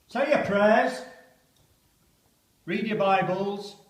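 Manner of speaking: urgent recital, loud at first, settling down; poem recital with rising tension; dramatic poem reading. Acted. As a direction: read it like a soldier waiting to go over.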